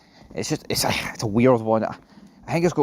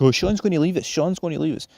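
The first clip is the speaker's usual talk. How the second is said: higher, whiny nerd voice; weird-voiced wee man; 8 semitones higher than usual. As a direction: whine it like the nerdy guy. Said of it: "He has a really weird voice".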